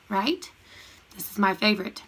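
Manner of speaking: usual speaking voice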